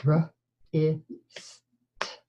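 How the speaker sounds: R, I, st, each sound split apart; sounding out words. Shown as a demonstration. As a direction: segment wrist into R, I, st with pauses. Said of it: "One more time".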